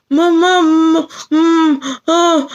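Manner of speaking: high-pitched wordless wail, imitated; imitated crying. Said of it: "she kinda sounds like this".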